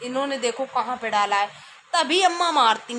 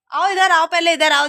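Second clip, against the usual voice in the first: louder, calling him to come over; 4 semitones higher, 6 dB louder than usual. Real, not acted. Mother calling her son over for the video, loud.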